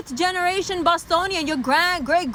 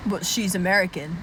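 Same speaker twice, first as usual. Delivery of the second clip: Indian American; 9 semitones lower than usual. Retold or imitated. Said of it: "I met an Indian person who was".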